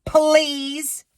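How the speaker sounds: high, sneering "Please"; mocking the cashier's snotty please; sarcastic please. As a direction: throw "Please" back with contempt. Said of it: "she goes".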